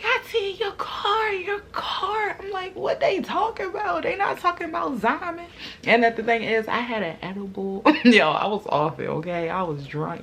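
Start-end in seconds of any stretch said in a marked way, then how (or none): 0.0-5.5 panicked wake-up. Retold or imitated. Friends waking her up about the car, panicked and high. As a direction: voice them as if panicking.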